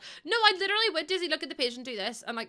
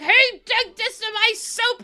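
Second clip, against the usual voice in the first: high, loud mock angry granddad; 11 semitones higher, 10 dB louder than usual. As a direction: play the furious granddad, slowly. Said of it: "and he was like".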